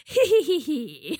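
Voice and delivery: high cackling hee hee; witch cackle. Pretend. Spooky season witch cackle.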